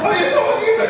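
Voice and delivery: very high, shouted incredulity; acted shriek of exasperation; frantic disbelief. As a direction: explode in bafflement at your wife. Husband's disbelief reaches its peak.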